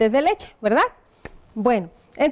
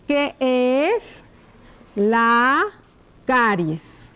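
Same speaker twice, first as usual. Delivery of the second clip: word by word, long pauses between; slow dictation; much slower than usual. Shown as a demonstration. Teacher writing a question on the board, slow dictation. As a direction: stretch each word out as you write it.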